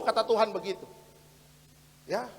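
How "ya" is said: ordinary talk, nothing marked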